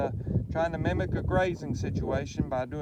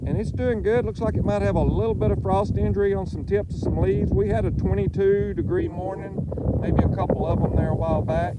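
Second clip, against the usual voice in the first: loud and raised over gusts; shouting over wind; 3 semitones higher, 7 dB louder than usual. Real, not acted. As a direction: push the voice over howling wind. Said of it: "I hope y'all can hear me".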